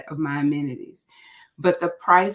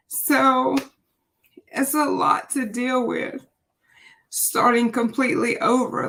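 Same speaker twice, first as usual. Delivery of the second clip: emotional; 6 semitones higher than usual. Real, not acted. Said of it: "I'm just a little emotional".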